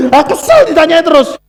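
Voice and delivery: annoyed words through a laugh. Real, not acted. Preacher recalling the call, laughing annoyance. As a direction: chuckle while complaining. Laughing about his irritation.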